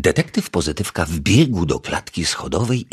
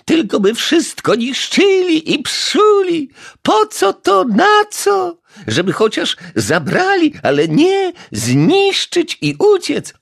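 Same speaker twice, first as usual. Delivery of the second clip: fuming; 5 dB louder than usual. A narrator's reading. Neighbour fumes at vandals from the balcony, high shrill fury. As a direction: spit out your rage at the hooligans. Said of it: "piekliła się".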